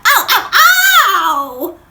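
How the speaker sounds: high, loud, slow drawn-out ows; hammed-up yelps of pain; mock ows. Drop knocking the pipe sides, loud hammed-up ows.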